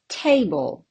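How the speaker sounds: TAble, firm first syllable, light ending; stress on first syllable. Shown as a demonstration. Teacher repeating the answer for learners, first syllable stressed. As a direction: hit the "Ta" in Table. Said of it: "on the first syllable".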